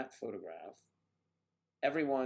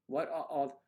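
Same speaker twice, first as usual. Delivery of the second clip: ordinary delivery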